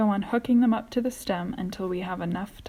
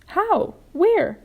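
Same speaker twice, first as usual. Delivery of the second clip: eager; 12 semitones higher, 8 dB louder than usual. A narrator's reading. James excited, asking how.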